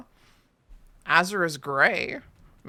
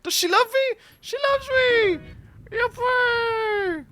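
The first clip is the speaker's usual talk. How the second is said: much higher and louder, cheering; gleeful squeal; 4 dB louder than usual. Pretend. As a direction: cheer it like winning. Petal game triumphant ending.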